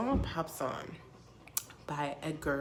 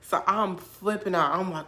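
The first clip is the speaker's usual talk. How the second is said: stunned amazement; 3 semitones higher, 16 dB louder, slower than usual. Retold or imitated. Storyteller reacting to the lyric she heard, loud amazement. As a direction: explode in astonishment.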